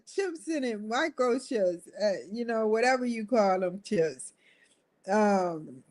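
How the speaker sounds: ordinary talk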